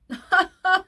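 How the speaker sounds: high, loud cackling laugh. Real, not acted. Reacting to him saying no, loud high laugh. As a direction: laugh out in disbelief.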